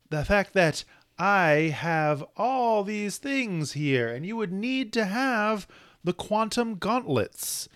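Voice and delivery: megalomaniac rant. Retold or imitated. Voicing the villain's monologue.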